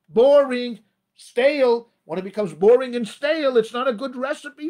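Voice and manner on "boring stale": punchy single words, louder; emphatic. Acted. Rabbi stressing how marriage goes stale, punchy and loud. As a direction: spit each word out with disdain.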